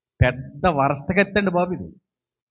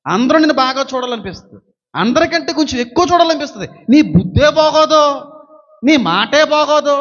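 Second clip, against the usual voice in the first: forceful preaching; 8 semitones higher, 5 dB louder than usual. Acted. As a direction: drive each line home from the pulpit.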